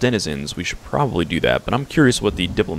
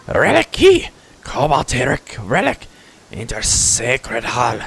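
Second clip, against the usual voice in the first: raised, halting creature voice; kobold chief; 4 semitones higher, slower than usual. Acted. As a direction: pidgin kobold explaining in bursts. Kobold chief explains the relic.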